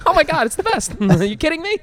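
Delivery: very high, loud parody rapture; mock gushing. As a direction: gush like a hammed-up superfan. Acts out gushing fan answer.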